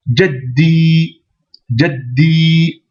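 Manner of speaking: jaddi with the d held before the i; doubled d. Shown as a demonstration. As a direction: block the d in jaddi then release. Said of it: "le del avec une shedda et un i".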